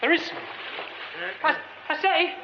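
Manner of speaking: trapped man shouting up to a stranger on the stage, frantic pleading calls. Acted. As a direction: shout up to the stranger, urgent and scared. Calling to an unseen stranger.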